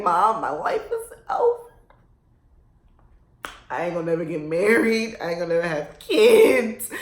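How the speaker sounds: hammed-up crying over a boy; mock wailing. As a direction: wail it like a melodramatic heartbroken girl. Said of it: "I used to be like".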